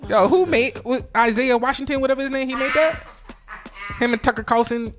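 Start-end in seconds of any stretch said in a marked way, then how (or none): none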